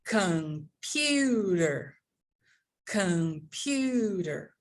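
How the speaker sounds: very slow "computer", PU stretched right out; com... PYUUU-ter, exaggerated slow "computer"; exaggerated word stress. Shown as a demonstration. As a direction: pull the middle of "computer" like elastic. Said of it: "So we are going to do".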